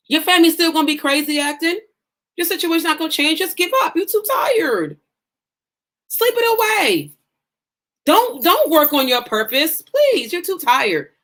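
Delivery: hammed-up voice of defeat; mock death talk. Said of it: "Death talk says".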